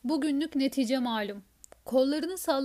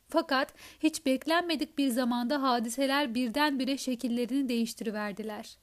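plain reading voice

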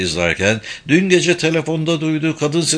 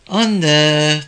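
higher, drawn-out whine to mother; sighing protest; 5 semitones higher, 4 dB louder than usual. A narrator's reading. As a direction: groan the word at your mother in protest. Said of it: "diye iç geçirdi Benjamin". (iç geçirerek, sızlanan oğlan sesi)

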